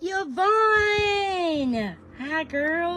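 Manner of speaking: high delighted cry of a name; excited greeting. Real, not acted. Streamer greets a viewer with an excited high cry. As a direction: greet a friend with joy.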